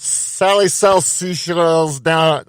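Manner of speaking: slow tongue twister with mouth full; struggling through words around mouthpiece. Real, not acted. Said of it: "let's try a tongue twister".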